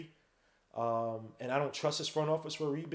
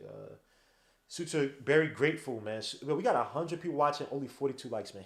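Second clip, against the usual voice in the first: ordinary talk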